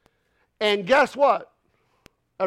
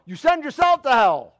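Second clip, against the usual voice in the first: ordinary talk, nothing marked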